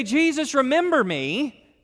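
a pushy plea that expects credit; boastful mock plea. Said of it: "He doesn't say".

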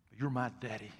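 usual speaking voice

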